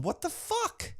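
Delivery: very high, squeaky retold bewilderment; mock disbelief. Fan voicing the baffled ref, mock high-pitched disbelief. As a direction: leap way up in pitch, softly, in comic disbelief.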